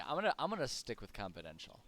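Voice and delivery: ordinary talk, nothing marked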